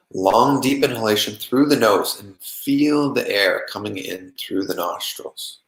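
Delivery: calm, even meditation voice with long pauses; unhurried, settled meditation guiding; guided meditation. Acted. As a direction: guide them gently into relaxation, leaving long silences.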